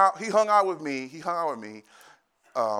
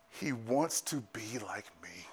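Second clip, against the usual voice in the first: tender whisper; 3 semitones lower, 10 dB quieter, slower than usual. Real, not acted. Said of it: "unvoiced whisper, moved".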